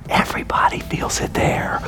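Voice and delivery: hushed, whispered reveal; whispered punchline; confiding whisper. Real, not acted. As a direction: drop to a whisper as if letting them in on it. Mock confiding answer to "guess what.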